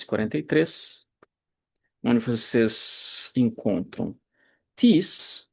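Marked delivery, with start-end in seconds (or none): 4.7-5.5 "tis" said high with a marked accent on the vowel. Shown as a demonstration. Teacher showing students the accented Greek pronoun, stressed. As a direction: stress "tis" sharply, higher. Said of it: "com acento".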